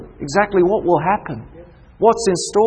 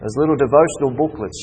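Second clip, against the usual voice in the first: ordinary talk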